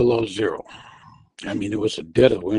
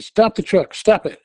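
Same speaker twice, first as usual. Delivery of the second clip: high, loud retold order; urgent command; 9 semitones higher, 4 dB louder than usual. Wife ordering her husband to stop, raised urgent voice. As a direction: bark stop at him.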